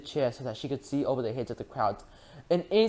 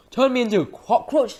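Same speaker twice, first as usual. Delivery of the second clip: much higher, loud outraged shout; indignant shout; 10 semitones higher, 7 dB louder than usual. A narrator's reading. Mister Jenkins outraged at grandma, shouting. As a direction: bellow it in disbelief. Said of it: "shouted Mister Jenkins".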